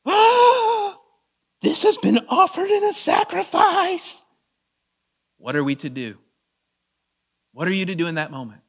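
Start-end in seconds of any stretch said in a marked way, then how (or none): 0.0-4.2 high, loud mock-horrified gasp and cry; shocked objector. Dinner guest objecting to sacrificed meat, mock-horrified high cry. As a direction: cry out in exaggerated horror, far above your usual pitch. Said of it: "in the tone of voice that they would say it".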